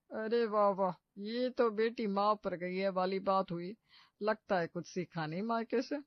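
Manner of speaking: mocking neighbor. Neighbor mocks slowly.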